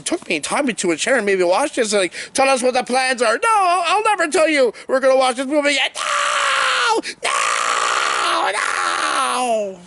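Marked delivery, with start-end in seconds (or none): 2.3-3.4 higher-pitched, lightly acted demand; mock interrogator. Torturer questions a tied-up captive, mock demanding. 3.4-6.0 high, hammed-up tearful defiance; mock anguish. Captive tortured by a bad movie, mock anguished. Acted victim defying torturers. 6.0-9.8 long drawn-out, high-pitched "no" wails; hammed-up torture victim howling; mock agonized scream. Captive forced to watch the movie, mock agonized screaming.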